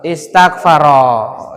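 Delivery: "Istaghfaro" low and drawn out; slow model reading. Shown as a demonstration. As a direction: sound out "Istaghfaro" syllable by syllable. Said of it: "Apa bacanya, Pak?".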